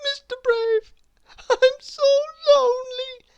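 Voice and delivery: high, teary little plea; sobbing. A narrator's reading. Little Miss Somersault sobbing to Mister Brave, high and teary. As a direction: cry out lonely and small. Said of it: "she sobbed".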